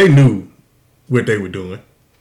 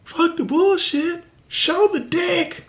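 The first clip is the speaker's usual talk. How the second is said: mock yell; 3 dB quieter, much slower than usual. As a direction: drag out a heckler's call from the stairs. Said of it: "He yells out from the stairs".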